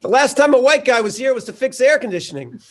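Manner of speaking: surprised realization. Retold or imitated. Church woman realizing the visitor is Ethan Hawke, surprised high exclaim. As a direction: play her sudden shock at who he is. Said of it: "And she's like".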